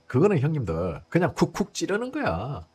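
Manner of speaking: normal talking voice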